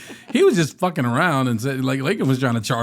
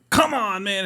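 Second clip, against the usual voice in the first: frustrated; 8 semitones higher than usual. Retold or imitated. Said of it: "We were just like".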